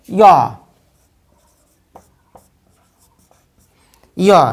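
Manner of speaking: "ইয়" as a y glide, "yo". Shown as a demonstration. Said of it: "এর উচ্চারণ হওয়া উচিত ছিল".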